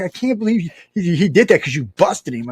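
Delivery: high-pitched retold amazement; amazed disbelief. Friend learns the man was busted, amazed disbelief.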